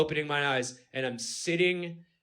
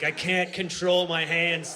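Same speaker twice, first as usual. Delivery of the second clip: ordinary delivery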